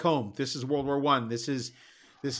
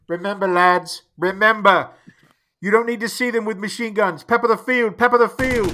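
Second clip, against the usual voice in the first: higher, loud officer's yell; 8 semitones higher, 10 dB louder than usual. Acted. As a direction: bark orders over the radio. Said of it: "Sid yells into the radio".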